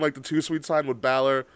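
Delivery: normal talking voice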